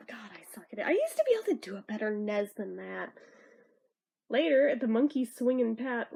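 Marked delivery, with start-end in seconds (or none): 0.1-0.9 breathy whisper to herself; real whisper. Real, not acted. Reader embarrassed at her own Texan accent, whispering. Muttering after failed accent.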